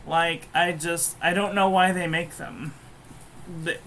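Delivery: usual speaking voice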